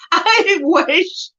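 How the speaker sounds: normal talking voice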